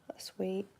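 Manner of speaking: unvoiced aside; whisper. Real, not acted. Thanking a viewer in a whisper. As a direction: breathe it out softly. Reacting to kind viewer comment.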